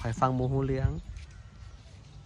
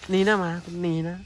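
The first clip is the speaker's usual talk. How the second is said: raised, higher voice shooing dogs; shooing shout; 6 semitones higher, 4 dB louder than usual. Real, not acted. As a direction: chase the dogs off.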